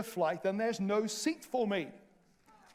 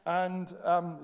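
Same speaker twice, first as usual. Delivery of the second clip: ordinary talk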